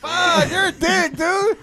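mock scolding. Mock scolding over dick pic.

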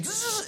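short, high, soft drill whine; quick imitated drill; drill buzz. Shown as a demonstration. Pastor in the dentist chair story, brief drill buzz. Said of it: "working on me in the machine".

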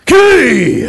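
high, loud war cry; haka shout. Pretend. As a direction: bellow it like a haka.

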